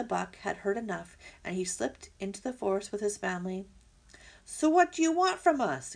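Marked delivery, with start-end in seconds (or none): 4.4-5.9 growling fox. Acted. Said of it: "growled Fink".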